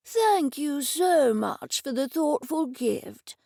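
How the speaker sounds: put-on slow, shaky old man voice; the old office owner welcomes Edison, slow and quavering; elderly man. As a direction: speak like a frail old gentleman. Old Mister Dennison voiced.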